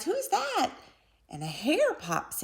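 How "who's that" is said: high squeaky little mouse cry. A narrator's reading. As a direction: shriek it like a startled tiny mouse. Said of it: "Mouse cries".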